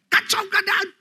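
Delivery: normal talking voice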